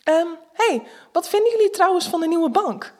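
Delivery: hastily distract the friends from the stripper; sudden change of subject. Acted. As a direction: narrator blurting out a distraction, abrupt and high. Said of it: "zeg ik ineens".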